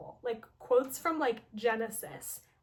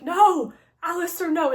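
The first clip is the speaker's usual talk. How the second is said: loud, higher pleading no; desperate protest; 5 semitones higher, 8 dB louder than usual. Retold or imitated. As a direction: cry out to stop him. Heroine pleads against the order.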